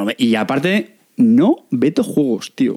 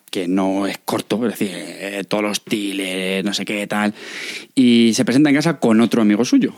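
normal talking voice

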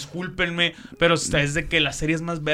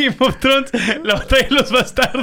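teasing question, higher and louder with laughter; laughing; 8 semitones higher, 5 dB louder than usual. Real, not acted. Teasing friend about Game of Thrones, laughing.

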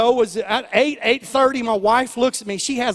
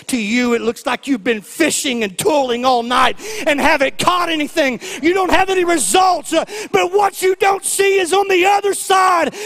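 loud, climbing preacher's cry; full-force preaching; 5 semitones higher, 3 dB louder than usual. Acted. Preacher to the congregation, loud and rising. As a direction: preach it up to the rafters.

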